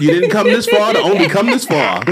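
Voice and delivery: pumped-up motivational pep talk; rally the listeners, laughing; hype man voice. Acted. Mock motivational plea to listeners.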